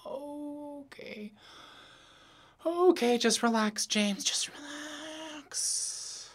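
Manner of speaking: woozy, fighting to stay steady; dizzy. Acted. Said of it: "Whoa, I feel dizzy".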